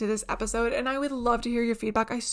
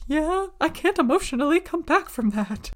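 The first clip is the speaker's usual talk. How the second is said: a mock awkward whine, louder and higher; socially awkward self; 6 semitones higher, 6 dB louder than usual. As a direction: play your own awkward panic, hammed up. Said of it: "my socially awkward ass is like".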